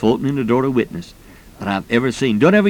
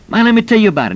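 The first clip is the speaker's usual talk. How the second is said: high, louder retold eagerness; excited sharing; 10 semitones higher, 5 dB louder, faster than usual. Class member back a few weeks later to share, excited. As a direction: burst in eager to share the news.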